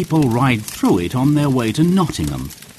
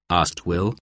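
plain reading voice

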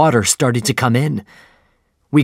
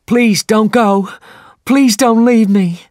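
much higher, louder, slow pleading cry; shouted plea; 11 semitones higher, 6 dB louder than usual. A narrator's reading. Pip from the window to Ahab, high pleading shout. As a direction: beg him not to leave. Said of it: "He shouted to Captain Ahab".

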